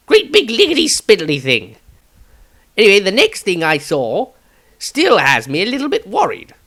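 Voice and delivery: Maestro's eccentric wizard voice. Acted. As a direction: chatter like a dotty, excitable wizard. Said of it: "Extract from the Diary of Maestro".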